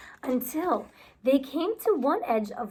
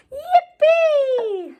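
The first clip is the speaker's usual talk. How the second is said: joyful cheer; 12 semitones higher, 7 dB louder than usual. Acted. Duck cheers joining.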